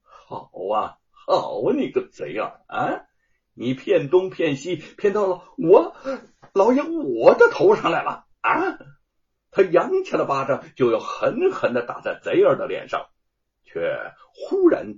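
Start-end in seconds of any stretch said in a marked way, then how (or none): 0.0-8.8 rising fury at a cheat; seething scolding. A narrator's reading. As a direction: curse through gritted teeth, then explode. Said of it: "咬牙切齿地骂道".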